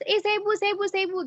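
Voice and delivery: high-pitched pupils clamoring for the teacher; murid berebut. Acted. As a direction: imitate eager kids begging to be picked. Murid berebut menjawab pertanyaan guru. (menirukan murid berebut, bernada tinggi)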